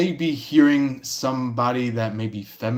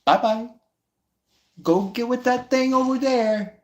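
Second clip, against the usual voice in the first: much higher, mocking send-off; sassy dismissal; 10 semitones higher than usual. Tarot reader mocks someone sending a pansy away. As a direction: wave them off with sass. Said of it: "you're just like".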